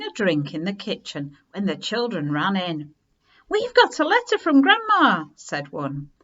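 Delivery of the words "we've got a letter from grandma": put-on high, loud, eager child voice; a child bursting with news; excited child. A narrator's reading. Children telling Harry's family about Grandma's letter, excited.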